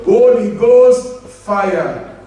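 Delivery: drawn-out, slow Holy Ghost fire; slowly called fire; slow declaration. Retold or imitated. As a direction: stretch every word like a war cry. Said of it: "I will call fire".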